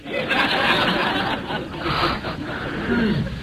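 breathy amused laugh at the punchline; real laugh. Real, not acted. Laughing at his own story.

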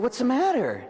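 high, urgent what's the matter; a priest asking a sobbing kid what's wrong, pitched high; asking "What's the matter?" high and pressing; concerned question. Retold or imitated. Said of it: "And I said".

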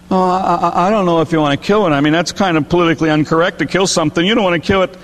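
mock hemming and hawing, stammered then hurried; hesitant objection. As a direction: play a waverer making quick excuses. Acts man balking at angel's offer.

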